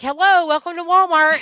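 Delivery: high, loud chirpy greeter welcome; mock cheerful store greeting; joking to the class in a greeter voice; Walmart greeter. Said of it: "I joke with my kids. I'm like".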